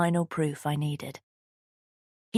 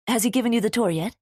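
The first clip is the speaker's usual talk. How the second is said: put-on fast, louder, higher Swedish-accented question; 6 semitones higher, 5 dB louder, faster than usual. A narrator's reading. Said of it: "Her Swedish accent was strong".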